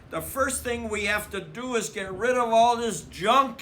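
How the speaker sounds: bustling mother set on clearing junk; brisk Mama. A narrator's reading. Mama telling the cubs to clear junk, brisk and loud.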